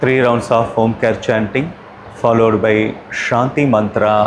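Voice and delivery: ordinary delivery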